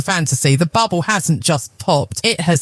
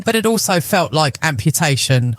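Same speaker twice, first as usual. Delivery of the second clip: ordinary delivery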